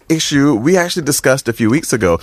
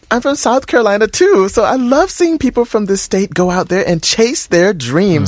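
lifted, higher woman's voice reading a fan letter; female voice; 9 semitones higher than usual. Acted. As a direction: read it as the young woman who wrote in. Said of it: "should I read it in the female's voice".

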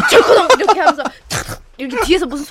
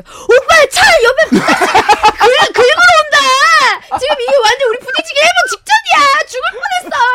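very high, loud hammed-up alarm; comic shrieking panic, half laughing; panicked passenger; 14 semitones higher, 7 dB louder than usual. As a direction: wail as if a crash is coming. Acting out own passenger panic.

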